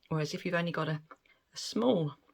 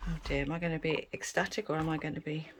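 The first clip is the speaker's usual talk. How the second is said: usual speaking voice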